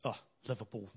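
very soft, weary groan of a name; bored mock sigh. Jesus in heaven pictured as unimpressed, mock bored sigh. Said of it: "Jesus is in heaven going".

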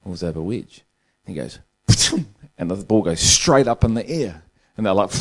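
normal talking voice

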